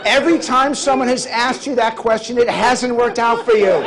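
loud, high comic rant; hammed-up scolding at full volume; mock exasperated scolding. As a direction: holler in mock disbelief over a laughing crowd.

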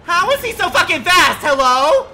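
very high, loud yell while being chased; panicked shout. Real, not acted. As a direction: scream at the fast killer. Chased by killer.

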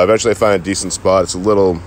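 ordinary talk

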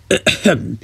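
awkward, pointed throat-clear; small pointed ahem. Acted. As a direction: clear the throat mid-sentence. Awkward pause in speech.

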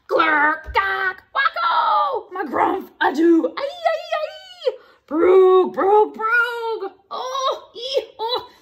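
loud goofy nonsense shouts, leaping high; silly sounds. Acted. Grown-up made to say silly sounds, loud goofy noises. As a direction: belt out each noise with abandon. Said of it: "say the silly sounds".

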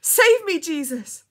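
high, very loud plea, falling; crying out. A narrator's reading. Dying girl praying aloud, desperate cry. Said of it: "and cried out".

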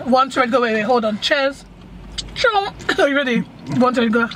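ordinary talk

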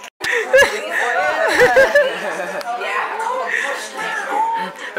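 high shouted laughter. Real, not acted. Laughing at loud moment.

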